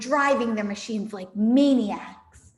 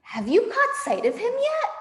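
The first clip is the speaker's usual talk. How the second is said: much higher, loud call; calling farmer Bean; 12 semitones higher, 4 dB louder than usual. Acted. Farmer Bean calling to the others over the machines. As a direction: call it back across the field. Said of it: "Bean called back".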